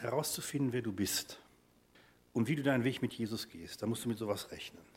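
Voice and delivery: usual speaking voice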